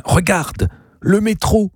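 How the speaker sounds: high-pitched, eager exclamation; sudden excited pointing. A narrator's reading. Gabriel showing Zazie the metro, excited. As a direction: point out a marvel far away. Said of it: "ajouta-t-il brusquement en désignant quelque chose".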